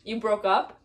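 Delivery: usual speaking voice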